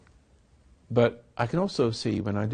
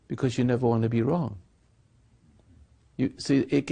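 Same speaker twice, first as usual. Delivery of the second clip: usual speaking voice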